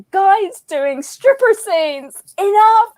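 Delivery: stretched words, then an emphatic high cutoff; exasperated rant. Retold or imitated. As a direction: imitate a fed-up lecturer putting her foot down. Retells a speaker's exasperated rant.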